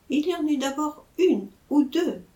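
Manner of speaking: plain reading voice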